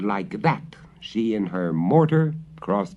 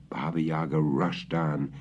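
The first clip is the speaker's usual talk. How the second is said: plain reading voice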